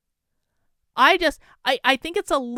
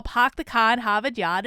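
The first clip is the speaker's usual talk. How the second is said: put-on Boston accent, R's dropped. Vlogger showing how the accent rubs off, Boston accent. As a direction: slip into a Boston accent for the catchphrase. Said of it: "I started to pick up a Boston accent".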